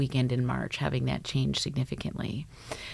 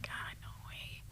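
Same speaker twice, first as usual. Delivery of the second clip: whispered reply; 20 dB quieter, slower than usual. Retold or imitated. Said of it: "I'm like".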